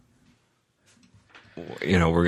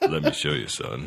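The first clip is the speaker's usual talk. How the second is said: a touch lower, fading fatherly voice; Sisko voice; 4 semitones lower than usual. Pretend. Co-host as Sisko answering his son, slightly deeper dad voice. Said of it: "deeper voice".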